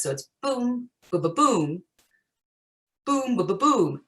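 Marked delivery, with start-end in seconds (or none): 0.4-1.8 "boom, ba-ba-boom" with a hard opening beat; trochaic inversion. Shown as a demonstration. Teacher demonstrating a trochee in verse, "boom, ba-ba-boom" stressed on the first beat. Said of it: "stressed syllable, and then it's unstressed". 3.0-4.0 "boom, ba-ba-boom" starting on a stressed high beat; trochaic inversion. Shown as a demonstration. Teacher showing how verse grabs attention, "boom, ba-ba-boom" stressed up front. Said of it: "start out with a stressed beat".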